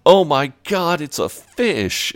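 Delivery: high, amazed squeal at a fish; mock astonished. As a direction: gasp it out, stunned by the catch. Boys shocked by huge fish.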